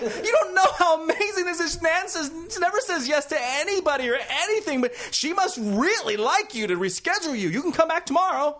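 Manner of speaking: gushing excitement. Retold or imitated. Agent telling actor the good news, high gushing. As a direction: gush the news, bubbling over.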